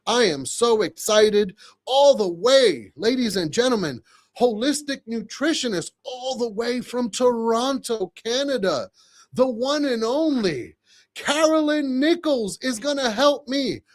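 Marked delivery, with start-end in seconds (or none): 1.9-13.8 hype announcer intro. Acted.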